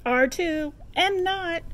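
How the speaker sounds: petty back and forth; childish squabble. Acted. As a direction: bicker like siblings.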